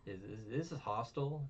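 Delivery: ordinary delivery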